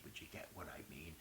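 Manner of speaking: hushed, voiceless aside to the audience; whispered aside. Real, not acted. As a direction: drop to a very soft whisper. Jokey aside after analogy.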